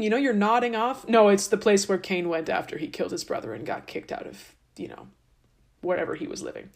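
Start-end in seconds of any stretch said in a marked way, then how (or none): none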